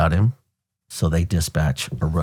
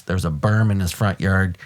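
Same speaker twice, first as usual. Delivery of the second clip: ordinary delivery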